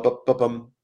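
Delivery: ordinary talk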